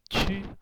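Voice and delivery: "ची" with air pushed out hard; short forceful "ची" through narrowed lips; aspirated, lips squeezed. Shown as a demonstration. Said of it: "हवा बाहर निक".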